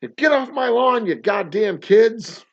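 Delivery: put-on high, loud cranky old man yelling at kids; hammed-up get-off-my-lawn geezer; grumpy old man. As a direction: shake your fist at the kids off your lawn.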